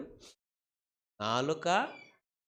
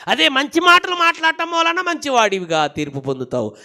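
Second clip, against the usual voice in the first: full-force preaching; 15 dB louder than usual. Real, not acted. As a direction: belt it out to the congregation.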